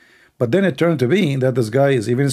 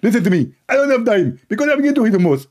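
high-pitched, irritated put-on voice; mock-annoyed, high telling-off; Allah; 9 semitones higher than usual. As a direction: scold the caller in a high comic voice. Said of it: "Allah, he answered".

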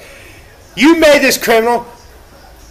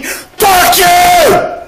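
furious shout; 7 semitones higher than usual. Real, not acted. Telling off society that hates him, furious yell. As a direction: spit it out as a defiant yell.

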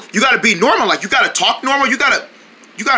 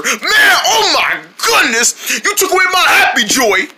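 higher, dramatic complaint; mock upset; 7 semitones higher than usual. Accusing friend of taking his happy joy, mock upset. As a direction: protest as if robbed of joy.

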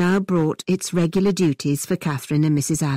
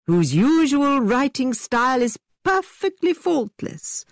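theatrical gentleman; 6 semitones higher than usual. A narrator's reading. Tilney's exaggerated dismay.

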